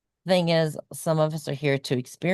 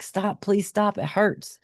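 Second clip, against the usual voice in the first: mild mock scream; 8 semitones higher, slower than usual. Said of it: "I'm screaming so much".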